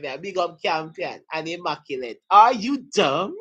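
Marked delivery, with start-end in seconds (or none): 2.3-3.4 teasing "Are you dumb?" with a rising sneer; mock insult, playful and loud; mock put-down. Teacher teasing the class with new slang, mock put-down. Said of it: "this is a new English slang".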